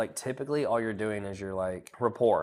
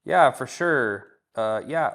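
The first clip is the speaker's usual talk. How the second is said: slow; 8 dB louder, much slower than usual. Shown as a demonstration. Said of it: "Or you could say".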